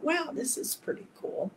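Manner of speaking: breathless hushed wow; awed whisper, pitched high; whispered wonder. Retold or imitated. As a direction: marvel softly at the scent. Awed by Maui flowers.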